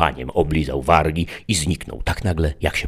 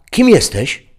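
higher question; 13 semitones higher, 8 dB louder, slower than usual. A narrator's reading. Question against Adaś's later plain one.

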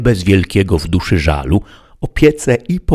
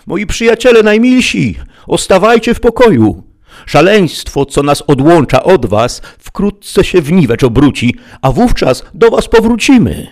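much higher and louder, wailing farewell; plaintive weeping; 12 semitones higher, 7 dB louder than usual. Acted. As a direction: wail goodbye to the donkey and horse. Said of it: "Tutaj zaczął płakać żewliwie".

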